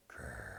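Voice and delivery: very low, soft rumbling growl. Acted. Narrator voicing the mystery sound, a low growl. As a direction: rumble it deep like a bear's belly.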